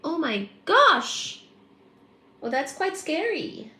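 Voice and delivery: high, swooping astonishment; high-pitched "how scary" reaction; spooked surprise. Real, not acted. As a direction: react amazed, stretching the words. Reacting to eerie comment.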